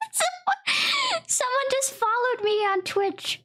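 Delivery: high, laughing exclaim; excited shout. Real, not acted. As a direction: blurt it out, half laughing. Shocked by a funny follower.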